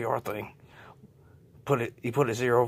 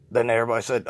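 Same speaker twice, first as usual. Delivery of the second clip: usual speaking voice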